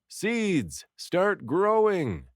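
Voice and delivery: high, slow bellow of a command. A narrator's reading. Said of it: "I bellow".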